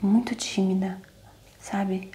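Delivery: soft, shy near-whisper trailing into a whisper; tímida, quase sussurrando; timid quiet voice. Shown as a demonstration. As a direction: show how a timid beginner mumbles to camera. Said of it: "imagina você começar a falar assim".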